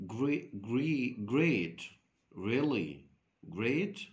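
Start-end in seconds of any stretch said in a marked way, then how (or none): none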